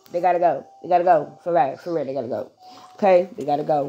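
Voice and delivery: deep, deliberate refrain; firm low repetition. Real, not acted. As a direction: hammer it home.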